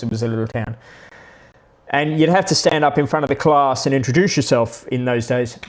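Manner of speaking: usual speaking voice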